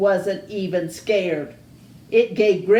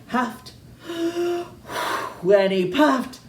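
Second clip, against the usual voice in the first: huffing puffing; 5 semitones higher, much slower than usual. Acted. Wolf acts out huffing.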